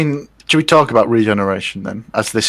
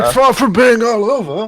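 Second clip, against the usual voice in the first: put-on high, quavering old man voice; old Doctor impression; 12 semitones higher than usual. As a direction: echo an old man's line. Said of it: "There he goes".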